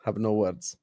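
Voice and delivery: normal talking voice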